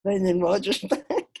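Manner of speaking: high, pleading demand for the manager; mock hostage demand. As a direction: act out the hostage taker's one request. Quoting hostage taker's demand.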